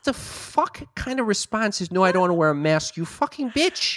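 indignant outburst at the passenger; outraged rant. Real, not acted. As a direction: rant in disbelief.

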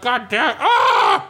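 mock pained yell of God damn; yelping God damn as the mask tightens; retold yell. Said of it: "and I'm like".

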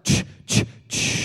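sharp hissing bursts of air; imitated ride braking. Showing the train coming in at the end, imitated hiss. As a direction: percussive chuffs, last one drawn out. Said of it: "It's like".

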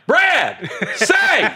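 high, loud mock hollering; imitated yelling. Headliner yelling for his crew from green room, mock hollering. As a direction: holler names as if down a hallway. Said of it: "in the green room yelling".

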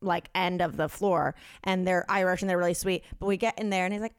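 ordinary talk, nothing marked

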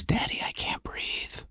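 whispered, breathless plea to a parent; little child's voice. Retold or imitated. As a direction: whisper it slowly, like a kid struggling for air. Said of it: "I hear a little voice".

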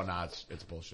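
low, quiet deadpan; deadpan dismissal. Retold or imitated. Booth owner answering a customer about his own product, deadpan. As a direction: toss it off quietly as if dead serious. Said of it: "I literally just went".

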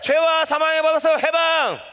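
high, drawn-out victory cry; rallying proclamation. Shown as a demonstration. Preacher leading congregation in proclaiming together, rallying cry. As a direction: lead the crowd in a slow triumphant chant. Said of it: "다시".

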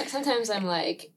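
laughing. Real, not acted. Laughing while telling.

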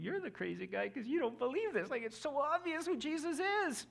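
high, lightly acted voice of disbelief; incredulous retort. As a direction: play Paul finding it obvious. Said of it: "He's probably like".

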